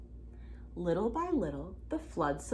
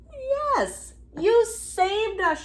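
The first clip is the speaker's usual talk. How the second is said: much higher, louder joyful cry; shouting with joy; 11 semitones higher, 5 dB louder, much slower than usual. A narrator's reading. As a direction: cheer the toad who saved them. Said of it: "shouted the frogs".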